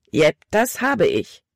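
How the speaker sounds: put-on mildly irritated boy in a story; annoyed. A narrator's reading. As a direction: make each word a cross admission. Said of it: "Caleb klang ärgerlich".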